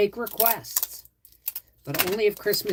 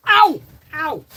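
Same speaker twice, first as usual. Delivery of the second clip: pained yelp; 9 semitones higher, 10 dB louder than usual. Real, not acted.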